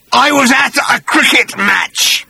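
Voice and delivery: a high, loud, slow monster voice grinding the words; rasping creature. Acted. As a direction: rasp it out like a wrecked old bat-creature. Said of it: "he rasped".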